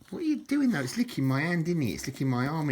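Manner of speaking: high-pitched, playful questioning; pet-directed talk. Real, not acted. Man to the animal licking his arm, high playful chiding. Talking to a creature licking him.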